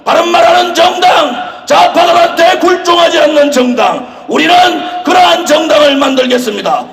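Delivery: roaring rallying speech. Acted. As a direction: rouse the crowd with chants. Rally leader chanting to crowd.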